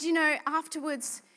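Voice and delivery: usual speaking voice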